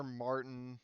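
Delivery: usual speaking voice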